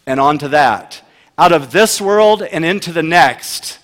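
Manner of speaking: loud, driving sermon voice; preaching at full force. Acted. Pastor preaching loudly to his congregation on anxiety.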